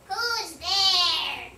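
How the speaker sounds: croaking. Acted. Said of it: "Frog croaked".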